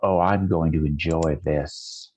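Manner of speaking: low, slow, relishing threat; menacing villain. Acted. Villain relishes the coming fight, low slow menace. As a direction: sink the voice and savour it. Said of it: "He says, he says".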